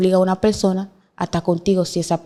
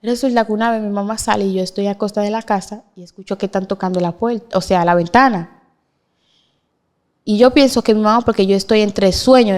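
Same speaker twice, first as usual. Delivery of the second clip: ordinary delivery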